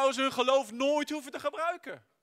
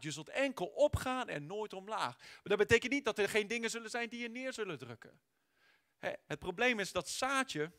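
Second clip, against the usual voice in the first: ordinary delivery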